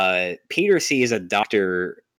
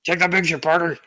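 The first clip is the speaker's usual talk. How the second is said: barking newspaper boss; 8 semitones higher, 4 dB louder, faster than usual. Retold or imitated. Quoting J Jonah Jameson bossing Peter.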